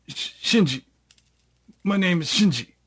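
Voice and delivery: lightly acted fearful stammer; scared, dazed captive. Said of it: "He's just scared".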